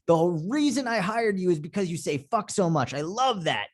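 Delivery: high-pitched gushing client; imitated delighted fan. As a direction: gush it high, like a thrilled client. Quotes delighted clients praising him.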